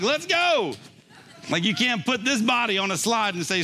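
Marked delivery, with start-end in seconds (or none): 0.0-0.7 high, eager "let's go" to the granddaughter; lightly pitched-up playful urging; excited urging. Retold or imitated. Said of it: "I'm like".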